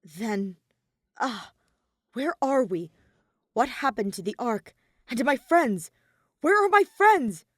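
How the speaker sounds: builds to loud high pleading; rising panic. Acted. Growing panic about his friends.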